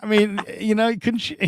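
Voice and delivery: high, indignant disbelief; a dad scolding the fans for pulling him over; incredulous dad. Retold or imitated. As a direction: protest like a dad with kids in the car. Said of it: "I'm like".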